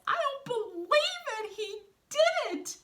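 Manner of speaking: very high, loud cry of disbelief; amazed Wolf figure. A narrator's reading. Reader voices Wolf amazed at his friend's success, astonished. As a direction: squeal it high, drawing out the end.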